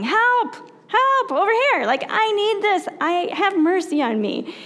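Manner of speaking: high-pitched mock cry for help; acted yelling. Blind men by the roadside calling to Jesus, yelling. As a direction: call out urgently, acting a plea from afar. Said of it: "they started yelling".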